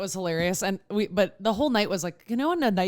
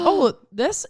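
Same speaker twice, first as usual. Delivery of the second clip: surprised; 8 semitones higher, 4 dB louder than usual. Retold or imitated. Caught off guard by question.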